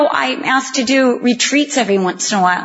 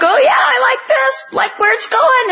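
much higher, gushing mock delight; 10 semitones higher than usual. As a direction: gush over the vessel like a pleased child. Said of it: "you're like".

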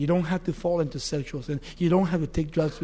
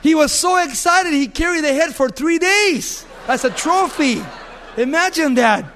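laughing amazement; 8 semitones higher, 6 dB louder than usual. Real, not acted. Preacher marvels, laughing at the story.

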